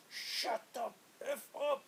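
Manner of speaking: high, drawn-out retold yell; angry cop. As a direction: stretch out each word like a fed-up cop. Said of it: "one cop turns around and goes".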